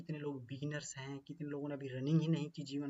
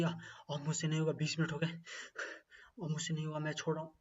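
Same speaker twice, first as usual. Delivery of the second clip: exhausted runner giving up. Acted. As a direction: let the words sag like you can't run another step.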